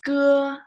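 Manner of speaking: "Ge" held high, loud and level; high level syllable. Shown as a demonstration. Teacher modelling a sound for learners, high level tone. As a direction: model "Ge" like "guh" on one high note.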